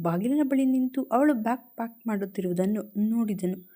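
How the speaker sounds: ordinary delivery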